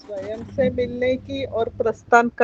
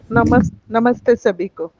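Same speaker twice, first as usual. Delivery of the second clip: speaking up to be heard; much louder, closer to the mic; 9 dB louder than usual. Real, not acted. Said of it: "आपकी आवाज़ थोड़ी कम आ रही है".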